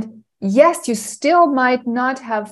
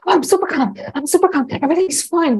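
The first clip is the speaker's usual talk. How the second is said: higher, forced sing-song reassurance; fake calm; 6 semitones higher, faster than usual. Parent telling kids it's fine in a falsely breezy voice. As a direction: pretend you're totally fine while you're not.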